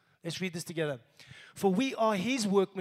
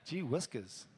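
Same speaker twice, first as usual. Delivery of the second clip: low muttered repeat; 6 semitones lower, 5 dB quieter than usual. Shown as a demonstration. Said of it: "I haven't said that in ages".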